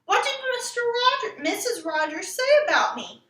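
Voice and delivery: high, pressing demand; eager demand. Acted. Ramona demanding her father's news, eager and pressing. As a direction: demand the answer right now. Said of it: "she demanded".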